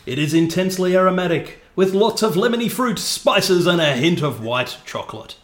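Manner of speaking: pompous, projected tasting-note reading; tosser radio voice. Pretend. Host reading beer tasting notes, mock connoisseur voice. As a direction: play the beer snob on radio. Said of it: "talking like a tosser".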